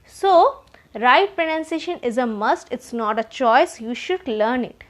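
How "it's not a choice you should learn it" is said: normal talking voice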